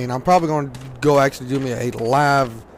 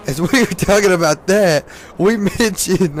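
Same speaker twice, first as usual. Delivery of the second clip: louder, higher, giggling mid-sentence; trying to talk through laughter; laughing while telling; 5 semitones higher, 5 dB louder than usual. Real, not acted. Laughing while retelling a story.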